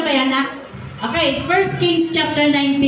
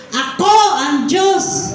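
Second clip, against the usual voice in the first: slow, higher proclamation; loud declaration; 5 semitones higher, slower than usual. Shown as a demonstration. Preacher leading the congregation to say it aloud, loud declaration. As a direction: declare it strongly, each word drawn out. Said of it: "Sabihin nga po natin, malakas".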